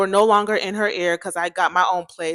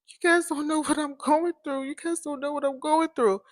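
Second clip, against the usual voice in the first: higher-pitched imitation of a crying friend; tearful; 7 semitones higher, 4 dB quieter than usual. Crying friend says nobody understands her, imitated tearful. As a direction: mimic her upset, high-voiced complaint. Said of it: "She just started crying".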